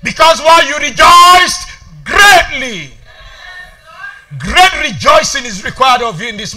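loud, high, driving sermon voice; full-force preaching. Real, not acted. Preacher telling the church miracles will silence mockers, full-force preaching. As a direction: hammer each phrase at the congregation.

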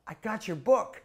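high, loud, proud; eager announcement. Retold or imitated. A boy proudly tells Prabhupada, high and loud. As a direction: tell him proudly.

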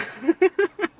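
high little laugh; a short amused giggle. Real, not acted. Laughs after explaining clapping.

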